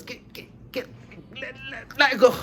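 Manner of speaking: effortful push building to a shout; straining enzyme. Acted. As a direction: act out prying the bond apart. Acting out the enzyme forcing apart.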